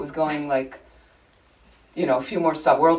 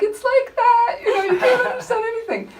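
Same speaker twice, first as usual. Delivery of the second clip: high, shouted garble through laughter; mimicking the unintelligible subway announcement; laughing mock announcer; 4 dB louder than usual. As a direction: squeal the nonsense announcement while cracking up. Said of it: "I'm like".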